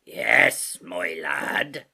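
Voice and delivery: put-on drawn-out old sea-cook voice; Long John Silver. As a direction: play the sailor landlord greeting a boy.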